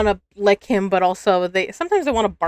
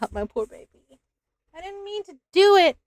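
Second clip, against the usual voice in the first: soothing pet talk; 10 semitones higher than usual. Real, not acted. Soothing hurt cat.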